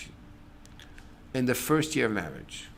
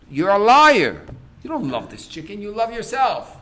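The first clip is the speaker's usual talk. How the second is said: accusing; 6 semitones higher, 9 dB louder than usual. Acted. Friend accuses chicken eater.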